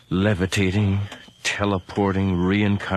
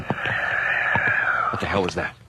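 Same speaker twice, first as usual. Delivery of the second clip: slow, hushed whisper at a strange noise; startled whisper; 4 semitones lower, much slower than usual. Acted. Man whispering to his companion after a noise in the dark house, hushed. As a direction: breathe it out, barely voiced, listening hard.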